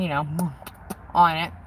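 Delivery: ordinary delivery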